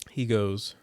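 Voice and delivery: usual speaking voice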